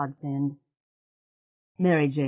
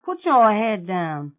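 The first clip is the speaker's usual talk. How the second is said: louder and slow, a caring command; firm order; 6 semitones higher, 7 dB louder, slower than usual. Acted. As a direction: tell her firmly to rest her head. Mary Jane orders Mona.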